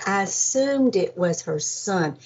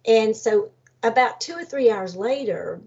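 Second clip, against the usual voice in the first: ordinary talk, nothing marked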